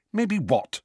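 high, impatient snap; snappish. A narrator's reading. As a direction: bite the question off. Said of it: "said Hermione rather snappishly".

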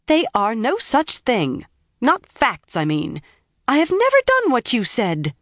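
high-pitched, emphatic protest; indignant denial. A narrator's reading. Nynaeve argues back at Egwene, indignant. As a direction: deny the accusation hotly, pitch jumping up.

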